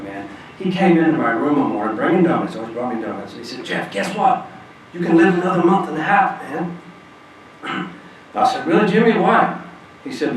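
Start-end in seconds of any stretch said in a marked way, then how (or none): 3.6-6.8 excited good news. Retold or imitated. Jimmy brings good news. 8.7-9.6 high, loud astonished question; surprised disbelief. Retold or imitated. Recalling his shocked reply to Jimmy, surprised and loud.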